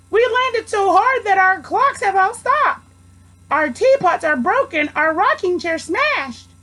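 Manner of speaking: very high, small pleading voice; tiny mayor pleading with Horton. A narrator's reading. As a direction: squeak it up from the speck. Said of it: "the voice of the mayor".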